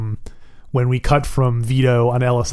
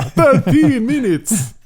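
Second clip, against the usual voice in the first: laughing; 9 semitones higher, slower than usual. Real, not acted. Laughing through the words.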